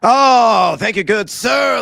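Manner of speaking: loud, theatrical thank-you; hammed-up gratitude to the host; excited shout. Mock-grand reply to host's introduction.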